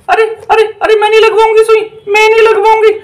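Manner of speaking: a witch begging not to get the shot; protesting witch. Acted. Witch refuses the injection.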